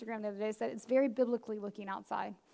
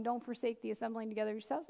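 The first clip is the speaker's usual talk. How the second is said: softer, lower, lightly rising; casual softer mock reading; breezy dismissal; 3 semitones lower, 7 dB quieter than usual. Shown as a demonstration. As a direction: toss it off like it's easy. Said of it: "it's real easy for us to do that one".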